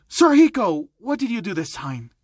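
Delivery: high, loud call of a name; yelling out. Acted. Nurse catching the old trainer at it, yelling out. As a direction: shout it like a scolding. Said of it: "someone would yell out".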